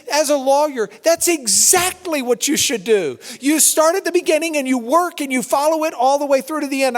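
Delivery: high, loud, elated rush of approval; euphoric excitement. Retold or imitated. Excited host applauding the professor's plan to read everything, euphoric. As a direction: cheer the plan on, pitched high and emphatic. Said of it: "because of anything other than euphoria".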